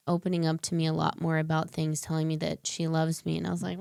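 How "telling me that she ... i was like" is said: ordinary talk, nothing marked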